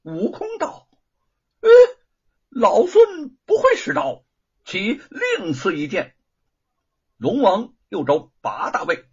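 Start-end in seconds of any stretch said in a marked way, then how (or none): 1.6-6.2 high, loud brash refusal, easing at the end; Monkey King. A narrator's reading. Monkey King turning down a blade, brash high voice.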